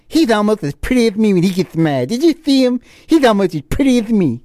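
camp it up like a preening wrestler; imitating Johnny B. Bad. Johnny B. Bad flirting with Stone Cold, high flamboyant mock voice. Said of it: "he may have been hitting on him".

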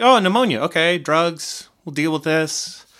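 high, light mock doctor. Doctors voiced casually.

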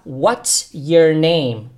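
slow, clear model of "What's your name?"; teacher modelling "What's your name?" very slowly; model phrase. Shown as a demonstration.

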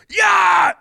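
long, high full-volume yell; overmodulated loud cheer. Retold or imitated. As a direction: imitate a fervent supporter screaming approval. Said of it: "it's, like, overmodulated and loud".

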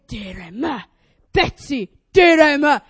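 firm call building to a loud order; authoritative voice. Shown as a demonstration. Owner summoning a straying dog, authoritative. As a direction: demonstrate a commanding dog-owner voice.

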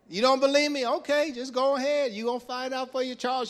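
high, loud mock challenge; warning elder. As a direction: dare the youngster to go ahead.

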